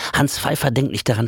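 ordinary delivery

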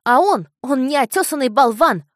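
put-on high, loud furious boy; shouting boy. A narrator's reading. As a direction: yell the insult at him. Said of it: "кричал мальчик".

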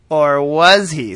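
loud, drawn-out tease of a twist; milk the reveal, slow and loud; big mock-suspense question; mock dramatic. Teasing mock-dramatic plot twist.

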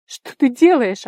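loud, high, drawn-out exclamation; shocked gasp. A narrator's reading. Tigir sees his friend untie her belt, aghast cry. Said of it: "ахнул он".

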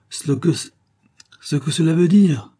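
high-pitched, stammering fright; broken, frightened delivery; trembling, halting. A narrator's reading. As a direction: falter and restart in fear. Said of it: "d'une voix tremblante et saccadée".

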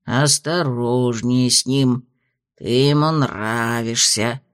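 slow, low and droning; grumbling tedious voice. A narrator's reading. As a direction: drone it like a tedious tutor. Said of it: "самым ворчливым, самым занудным голосом".

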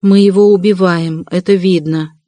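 usual speaking voice

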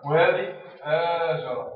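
هاجر said slow and loud; slow modelled word. Shown as a demonstration. Teacher contrasting two words for the pupils, slowly. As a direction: lean on the long a of هاجر. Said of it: "teacher contrasts words".

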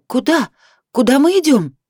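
stumbling over her words in a rush; flustered, stumbling. A narrator's reading. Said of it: "сбивчиво говорила".

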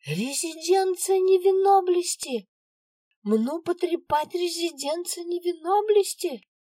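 very high, loud kitten squeal. A narrator's reading. Dangling girl keeps yelling.

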